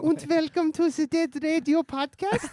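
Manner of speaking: put-on high, loud, slow German accent; hammy German accent announcing the podcast; creepy German accent. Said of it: "That's pretty accurate".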